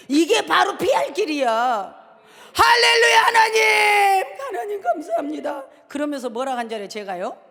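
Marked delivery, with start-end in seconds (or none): 2.5-5.7 loud high crying praise; fervent hallelujah. Real, not acted. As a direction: burst out in grateful praise. Thanks God for rescue. (울먹이며 외치는 할렐루야)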